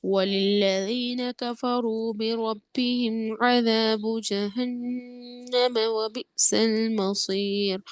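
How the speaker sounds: slow, drawn-out tajweed chant; Quran recitation. Shown as a demonstration. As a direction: recite slowly, stretching the long vowels. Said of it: "قواعد کا خیال رکھ کے پڑھیے".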